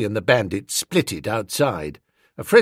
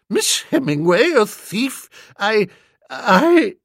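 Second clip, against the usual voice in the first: high, stammering shock; faltering aunt; 9 semitones higher, 5 dB louder than usual. A narrator's reading. Aunt learning the girl is a thief, faltering. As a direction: gasp it out, then falter on the last words. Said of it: "stopped and looked feebly at me".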